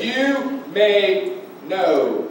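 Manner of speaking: YOU MAY KNOW drawn out very slowly, word by word; stressed words. Real, not acted. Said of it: "may I stress the words".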